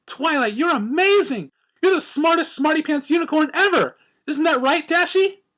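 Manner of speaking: very high, loud gushing; gushing praise. A narrator's reading. Pinkie praising Twilight to Dashy, high and gushing. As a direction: fangirl squeal of admiration.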